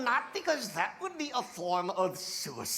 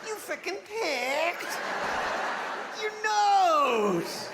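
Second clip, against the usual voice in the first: slow disgusted; 6 semitones higher, much slower than usual. Real, not acted. Watching a character pick his nose, slow disgust. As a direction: stretch it out, grossed out. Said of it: "disgusted at nose picking".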